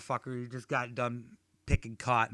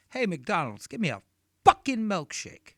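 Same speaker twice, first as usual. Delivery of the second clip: daughter ordering; 7 semitones higher, 7 dB louder than usual. Retold or imitated. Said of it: "My daughter's like".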